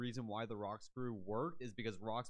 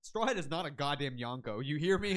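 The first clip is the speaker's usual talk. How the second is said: angry Lucci rant; 4 semitones higher, 6 dB louder than usual. Retold or imitated. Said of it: "He's like".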